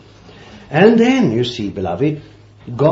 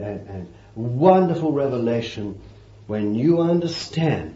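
ordinary talk, nothing marked

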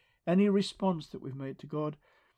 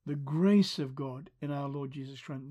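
usual speaking voice

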